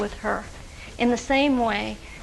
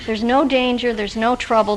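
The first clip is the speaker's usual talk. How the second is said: ordinary delivery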